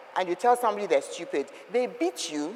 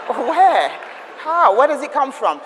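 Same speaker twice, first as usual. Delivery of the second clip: louder and higher, bewildered how and where; astonished outrage at the shortened name; indignant disbelief; 5 semitones higher, 7 dB louder, slower than usual. Real, not acted. Asking the audience, loud bewildered disbelief.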